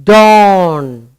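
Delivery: drawn-out "dawn" with an open aw vowel; modelled word. Shown as a demonstration. Teacher to children, modelling "dawn" clearly. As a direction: say "dawn" slowly and clearly, long aw. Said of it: "you call it".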